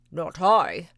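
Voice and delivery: loud gruff bark of a dog; lazy dog barking. Acted. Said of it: "barked the lazy dog".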